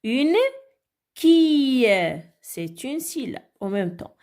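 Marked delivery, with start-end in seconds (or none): 0.0-1.9 Une, pause, qui louder and higher; syllable by syllable. Shown as a demonstration. Teacher cutting words up for children, Une - qui in separate beats. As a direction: isolate Une and draw out qui. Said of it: "découper les mots en syllabes".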